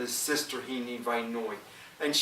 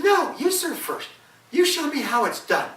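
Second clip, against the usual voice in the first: the wife urging her husband, high and loud; 12 semitones higher, 6 dB louder than usual. Acted. Wife voiced in folk tale.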